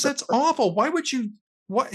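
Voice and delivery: mock-appalled dad scolding his daughter; indignant father.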